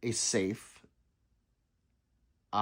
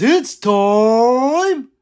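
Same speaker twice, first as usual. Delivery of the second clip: loud; 11 semitones higher, 13 dB louder than usual. Real, not acted. Reading chat's catchphrase.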